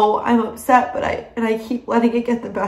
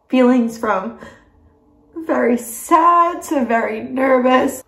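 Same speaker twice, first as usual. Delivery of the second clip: ordinary delivery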